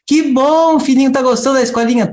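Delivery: high, bright, quick mock mother; sweet mother voice. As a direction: play the doting mother, up high. Mãe pergunta animada. (mãe carinhosa, aguda e rápida)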